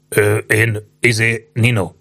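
understated nervous hesitation; trembling reply. A narrator's reading. Old man answers who he is, mildly trembling. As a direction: falter between words, mildly timid. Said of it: "hangzott a reszkető válasz".

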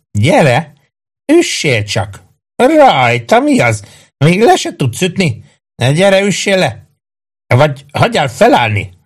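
high, goading cries; shouted taunts. A narrator's reading. Maggio dares the policemen to hit him, high taunting. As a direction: goad them in a high, strained-up pitch.

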